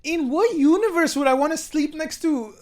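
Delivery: high-pitched comic outrage; incredulous. Real, not acted. Host objecting to a would-you-rather, incredulous. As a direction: protest it in high disbelief.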